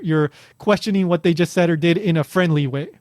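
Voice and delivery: ordinary talk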